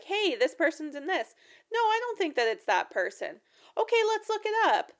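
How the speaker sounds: from doubt to eager lookup; lively mock argument rising to a high squeal; excited realization. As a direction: voice climbing high by the end. Friends arguing then checking.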